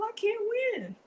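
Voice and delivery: high comic lament; lightly played defeated whine; mock defeat. Real, not acted. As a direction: whine it like you've lost. Lamenting being outnumbered.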